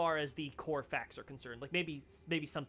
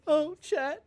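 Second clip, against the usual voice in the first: laughing; 11 semitones higher, 9 dB louder than usual. Real, not acted. Laughing at game betrayal.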